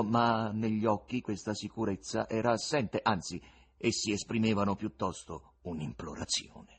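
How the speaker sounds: ordinary delivery, nothing marked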